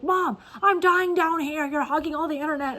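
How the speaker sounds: yelling son. Retold or imitated.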